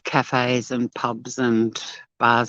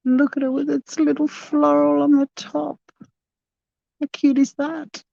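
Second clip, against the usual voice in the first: cooing over it; 13 semitones higher than usual. Real, not acted. Cooing over a pretty donut.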